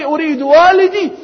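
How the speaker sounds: put-on weeping child's cry. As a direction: sob it out like a small girl. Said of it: "She starts to cry and cry".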